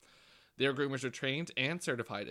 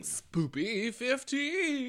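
slow drawn-out high echo of the code; 11 semitones higher, much slower than usual. Acted. Echoing promo code in voice.

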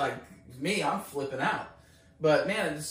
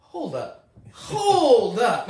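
loud, high, drawn-out second "hold up"; stunned disbelief; 7 semitones higher, 12 dB louder, much slower than usual. Retold or imitated. Caller reacting to her being so calm, stunned disbelief. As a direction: build from stunned to booming disbelief. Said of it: "I'm just like".

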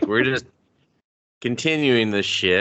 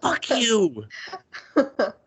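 mock furious cursing; 10 semitones higher, much slower than usual. Retold cursing at the show.

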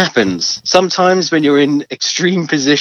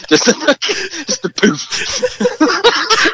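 laughing; much slower than usual. Real, not acted. Mimicking the gentle fart.

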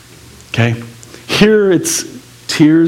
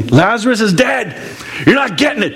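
pitched higher, impatient teacher spelling it out; exasperated Jesus; 7 semitones higher than usual. Acted. Preacher voicing exasperated Jesus.

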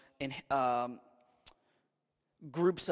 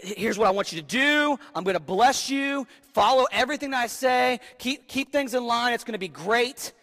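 mock God's message; 5 semitones higher, 5 dB louder than usual. Voicing God's Snapchat message.

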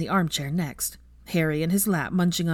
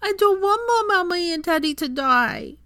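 put-on high wailing little child; a toddler bursting into tears over his parents; toddler crying; 13 semitones higher, 4 dB louder than usual. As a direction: wail it through tears like a scared toddler. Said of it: "burst into tears".

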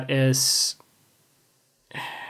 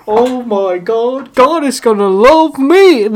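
high, loud, giddy gushing; mock rapture; 7 semitones higher, 7 dB louder, slower than usual. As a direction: gush like a blessed fan.

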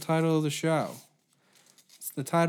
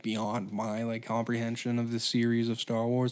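ordinary talk

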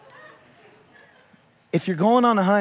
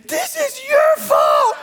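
high, slow wailing accusation; sob-shout the blame at him; crying angry shout; much slower than usual. Retold or imitated. Wife blames husband for the pregnancy, crying and shouting. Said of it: "and she said".

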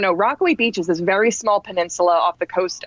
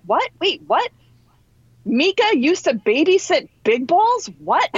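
mock-stunned questions, pitched high; high, drawn-out imitation of disbelief; retold incredulity; 8 semitones higher than usual. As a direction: imitate someone who can't believe the story, slowing on the repeat. Instructor's disbelief at the story, retold.

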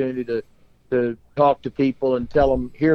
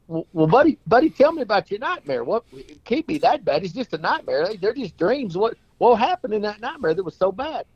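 higher, coaxing buddy voice; hammed-up friend; 7 semitones higher than usual. Friend urging the sad clown to talk, pitched high. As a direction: pitch it up and coax him to tell.

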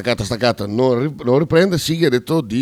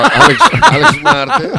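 excited; 6 dB louder, slower than usual. Real, not acted. Excited at video.

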